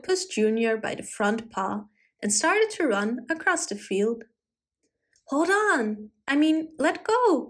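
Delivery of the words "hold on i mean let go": high-pitched protest, not shouted; alarmed cry. A narrator's reading. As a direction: cry out to be let go. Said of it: "cried Puss in Boots Junior".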